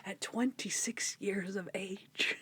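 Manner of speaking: hushed, slow, mock-scandalized whisper; soft, drawn-out imitation of a scandalized line; imitating Ambrose. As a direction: whisper it slowly, savoring every word. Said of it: "just the way that he says that".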